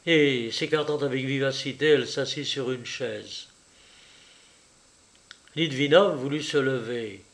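ordinary delivery

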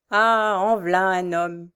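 mother exclaiming over her favourite son, admiring cry; lingering, proud exclamation; slow admiring cry. A narrator's reading. A mother's doting cry, drawn out. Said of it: "cria la Frochard".

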